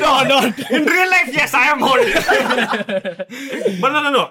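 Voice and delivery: high, loud, laughing through the words; cracking up while denying it; laughing loudly. Real, not acted.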